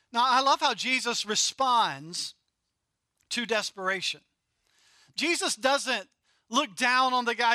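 ordinary delivery

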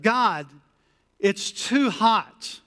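slow, drawn-out complaint; mild whine. Retold or imitated. Israelites complaining to God in the desert, a touch whiny. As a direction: draw it out like a tired grumbler. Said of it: "when the Israelites said".

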